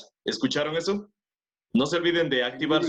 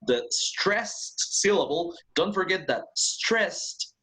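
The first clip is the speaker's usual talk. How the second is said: slow emphasis; 5 semitones higher, slower than usual. Shown as a demonstration. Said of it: "teacher emphasising a term".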